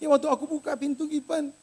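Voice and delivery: high, timid maid voice; mock fearful account. Maid explaining the door to her employer, imitated fear.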